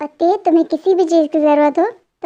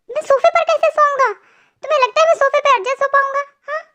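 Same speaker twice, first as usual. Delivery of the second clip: higher, fast, outraged disbelief; indignant protest; 10 semitones higher, faster than usual. Acted. Husband objecting to sleeping on the sofa, indignant and fast. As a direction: protest like he can't believe it.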